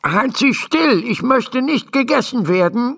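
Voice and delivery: a tiny man afraid of the horse's teeth; anxious little doctor. A narrator's reading. Doctor inside the mouth begs the horse to keep still, nervous.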